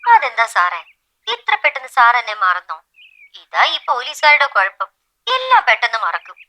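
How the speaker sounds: complaining voice down a phone line; phone-filtered mild reproach; chiding phone caller. Acted. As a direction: chide him over the telephone for forgetting. Caller chides him on phone.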